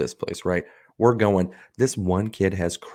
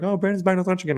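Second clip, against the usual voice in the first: mock coworkers; 12 semitones higher, faster than usual. Coworkers quoted.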